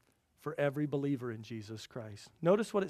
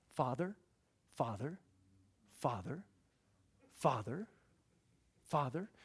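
repeated appeal; 6 dB quieter than usual. Acted. Believer persistently calling on the heavenly Father, soft rising appeal. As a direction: ask gently for attention, rising each time. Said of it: "our attitude ought to be".

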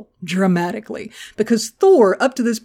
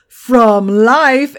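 "from life" stretched and louder after a pause; dramatic twist; 2 semitones higher, 6 dB louder than usual. Retold or imitated. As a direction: land "from life" as a loud punchline. Grandmaster's twist on a pardon.

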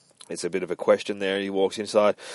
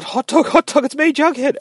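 much higher, louder, overjoyed calling to his dog; excited calling; 8 dB louder than usual. A narrator's reading. Jughead calls to his returned dog, excited and high. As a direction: call out to the lost dog with delighted relief. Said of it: "he gets all excited".

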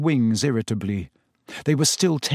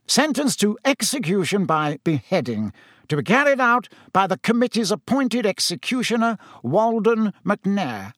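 higher, formal proclamation; official reading a sentence; 8 semitones higher than usual. Acted. Committee member reads out the death sentence, official and formal. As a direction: read it out ceremoniously, pitched up.

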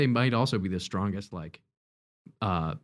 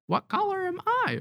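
very high squawky parrot voice; parrot impression. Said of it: "that's my parrot impression".

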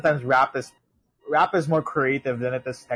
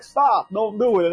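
higher, slower pleading warning; 8 semitones higher, 4 dB louder, slower than usual. Retold or imitated. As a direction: beg him to stop. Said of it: "[name] is like".

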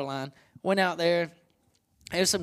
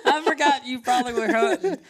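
laughing; 10 semitones higher, 5 dB louder than usual. Real, not acted. Teasing friend about missed calls.

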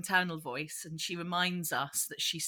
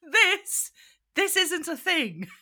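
high, squeaky mocking of a snooty critic; mock review voice; 9 semitones higher, 5 dB louder, slower than usual. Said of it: "was the review".

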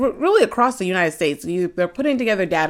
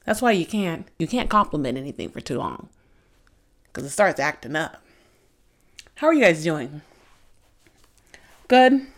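ordinary delivery

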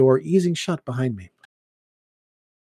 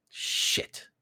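whispered curse; 11 dB quieter than usual. Acted. Muttered curse after checking time.

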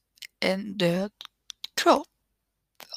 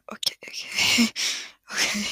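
nervous whisper. Real, not acted. Nervously approaching the body.

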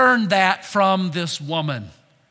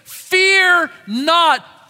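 higher, louder ringing FEAR not; louder call to repeat; 7 semitones higher, 5 dB louder than usual. Real, not acted. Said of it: "Say those words with me".

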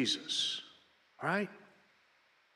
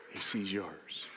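much lower, much softer "He sees yours"; hushed echo; 10 semitones lower, 7 dB quieter, slower than usual. Real, not acted. Preacher quietly repeating to the congregation, hushed and intimate. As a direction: let it fall almost to a whisper.

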